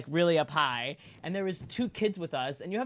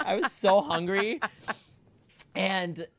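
ordinary delivery

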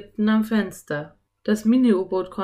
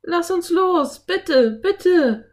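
put-on high, loud girlish plea; pleading call; 12 semitones higher, 8 dB louder, much slower than usual. A narrator's reading. Anne begging the giant octopus for release, pleading shout. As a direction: beg at the top of your voice. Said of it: "rief Anne dem Kraken zu".

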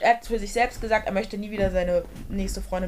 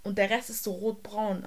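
ordinary talk, nothing marked

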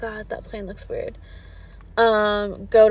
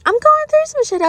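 much higher and louder, fed up; exasperated outburst; 14 semitones higher, 5 dB louder, faster than usual. Real, not acted. Venting to her viewers about pushy men, loud outburst. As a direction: burst out with it.

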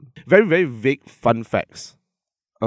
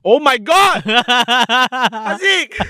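high, loud Oh my God; shocked delight; 11 semitones higher, 5 dB louder, much slower than usual. Real, not acted. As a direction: shriek out in disbelief.

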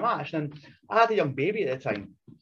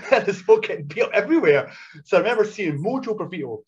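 raised, loud laughing delivery; 4 semitones higher, 6 dB louder than usual. Real, not acted. Wrestler recalling a scene of carnage, laughing loudly. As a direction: laugh through it, pitched up.

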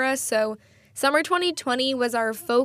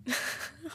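soft, breathy laugh at oneself; embarrassed laugh; 4 semitones higher, 11 dB quieter than usual. Real, not acted. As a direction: laugh off your own bad accent. Laughs at own bad accent.

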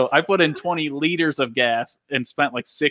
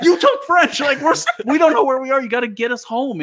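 high, loud, exasperated mock yell; retold yelling; 13 semitones higher, 4 dB louder than usual. Lost driver yelling at the friend who took French, exasperated. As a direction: re-enact yelling at the useless navigator, half laughing. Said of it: "I was yelling".

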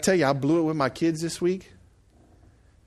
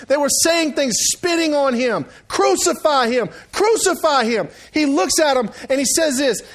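high, loud fired-up preaching; preaching at full force; 10 semitones higher, 5 dB louder than usual. Acted. Pastor preaching the crucifixion to his church, fired up. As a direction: drive the cross story home to the crowd.

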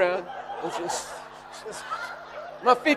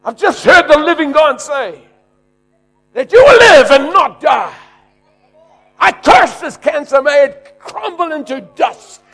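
preaching shout; 5 semitones higher, 9 dB louder than usual. Retold or imitated. Preacher shouting healing over the patient. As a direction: shout it over him like a commander.

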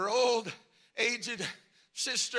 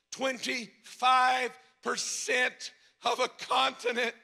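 ordinary talk, nothing marked

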